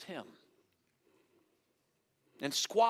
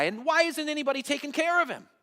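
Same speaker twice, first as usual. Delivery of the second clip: high-pitched acted outrage; upset, indignant; 10 semitones higher, 4 dB louder than usual. David protesting insults to Israel's God, upset and indignant. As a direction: demand an answer, voice pitched well up. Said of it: "But David's upset".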